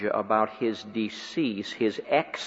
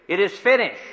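shouted; 8 semitones higher, 6 dB louder than usual. Retold or imitated. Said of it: "He shouted it".

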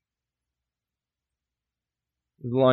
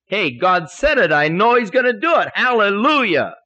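loud, high, ringing exhortation; fervent preaching; 7 semitones higher, 7 dB louder than usual. Real, not acted. Preacher urging believers to trust God's promises, fervent. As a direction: drive it home at full force.

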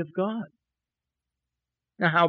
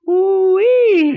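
high, loud "ooh-wee" whoop; overjoyed whoop; 11 dB louder than usual. Retold or imitated. Preacher reliving news of the check, overjoyed whoop. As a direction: squeal it out in delight. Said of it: "Boy, was I happy".

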